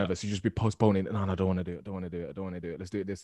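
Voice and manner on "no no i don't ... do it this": reluctant mumble. Retold or imitated. Voicing a nervous avoider.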